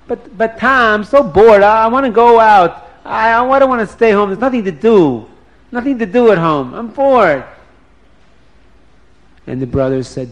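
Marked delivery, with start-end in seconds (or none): 0.1-7.4 loud, higher, whiny protest; bored whining. Acted. Dina pleads to leave the house, bored whining. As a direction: whine like a bored child begging.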